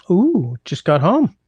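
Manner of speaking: welcoming a viewer arriving home; excited greeting. Real, not acted. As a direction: greet the newcomer with delight.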